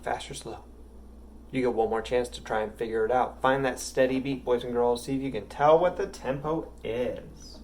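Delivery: ordinary talk, nothing marked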